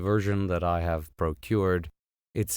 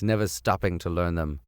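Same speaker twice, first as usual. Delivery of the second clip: ordinary delivery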